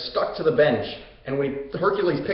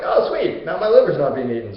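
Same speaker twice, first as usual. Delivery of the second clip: light up in a raised, pleased voice; delighted centaur; 4 dB louder than usual. Retold or imitated. Centaur freed from the liver-eating eagle, delighted. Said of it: "the centaur's like".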